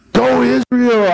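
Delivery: high, held cheer; mock yell. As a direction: call it out like a rallying crowd. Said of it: "what did they yell?".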